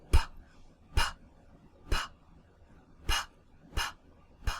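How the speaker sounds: "p" as a bare puff of air, no vowel, no voice; whispered p. Shown as a demonstration. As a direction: lips together, pop out "p" with air only. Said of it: "That's a whisper sound".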